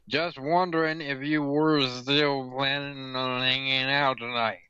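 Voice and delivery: hammed-up drunken slur; woozy, sliding mock-drunk delivery. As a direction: run the words together like a drunk. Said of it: "I'm guessing that's drunk".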